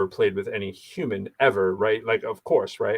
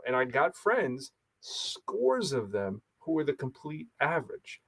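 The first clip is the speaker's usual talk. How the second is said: slow emphasis; 6 dB quieter, slower than usual. Real, not acted.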